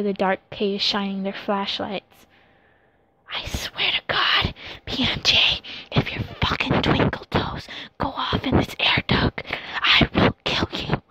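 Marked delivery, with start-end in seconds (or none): 3.3-11.0 whisper-yell the threat; stage whisper. Acted. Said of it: "Yimo whisper yelled".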